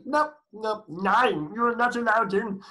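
high, loud mock German refusal; German accent. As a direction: shout it with a put-on German accent. Acting out Hitler expelling deputies.

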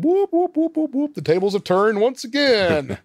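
high, comic run of whoops; playful sound-effect whooping; imitated whoop noise. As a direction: whoop high as tables flip. Mimics the tables turning.

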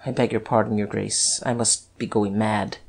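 low, quiet stammered apology; softly faltering; subdued, flustered apology. A narrator's reading. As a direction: apologise low and shaken, with small pauses. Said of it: "he stammered".

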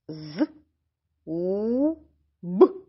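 З, у, б as three separate sounds; sounding out. Shown as a demonstration. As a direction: buzz З, hold у, pop б. Sounds out word zub.

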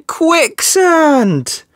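high, drawn-out alarm; dramatic reveal. Acted. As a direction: stretch the word out, pitched high.

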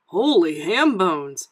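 loud, drawn-out delighted exclamation; amazed cry. A narrator's reading. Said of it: "he cried".

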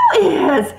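plain reading voice

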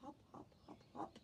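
breathy whisper of hop hop hop; soft unvoiced bunny hops. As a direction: whisper each hop lightly. Whispered hopping sound.

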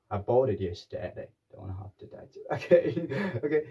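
normal talking voice

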